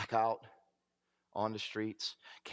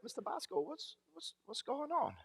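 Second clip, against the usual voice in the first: much higher, softer caring counselor voice; concerned counselor; 13 semitones higher, 7 dB quieter than usual. Retold or imitated. Counselor greeting a downcast client, much higher gentle concern. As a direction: voice the counselor's gentle, puzzled question. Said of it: "he's like".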